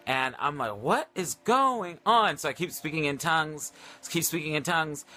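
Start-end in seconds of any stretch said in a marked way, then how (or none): 0.8-2.4 high, drawn-out disbelief; slow, incredulous retold exclamation; bewildered. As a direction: stretch each word in rising astonishment. Retold bewildered reaction to scary noises.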